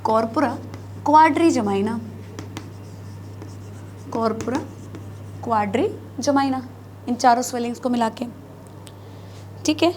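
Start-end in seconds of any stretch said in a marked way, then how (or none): 0.0-6.7 corpora क्वाड्रिजेमाइना spelled out word by word with long pauses; term said slowly for students. Shown as a demonstration. Teacher repeats the new term so students can write it, slow and deliberate. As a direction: pause after corpora before क्वाड्रिजेमाइना, every syllable clear. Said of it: "इन swellings को बोला जाता है corpora".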